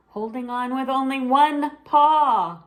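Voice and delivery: high, loud big-top announcer; a little girl playing ringmaster; showy announcer sister. Zelda announces the show.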